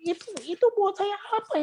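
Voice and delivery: high, hammed-up hype-man voice with a long yelled "Wuh"; mocking a hyped-up worship leader. Preacher mocking worship leaders, hammed-up high hype voice. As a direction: lampoon a pushy leader whipping up the crowd. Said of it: "Jadi orang teriak-teriak".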